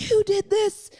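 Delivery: high-pitched mock-furious squeal; mock angry accusation. Mum retelling her phone call to Fran, mock mad. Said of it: "I got so mad".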